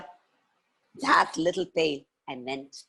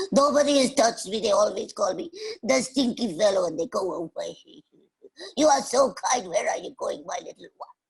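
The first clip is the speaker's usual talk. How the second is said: higher, sobbing grateful voice; crying boar; 6 semitones higher than usual. Acted. Boar thanking the kind girl, crying. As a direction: blubber your thanks in a hammed-up cry. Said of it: "started crying with a".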